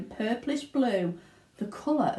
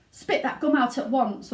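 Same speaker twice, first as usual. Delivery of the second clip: stern order; 5 semitones higher, 4 dB louder than usual. Acted. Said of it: "ordered Mister Beauregard".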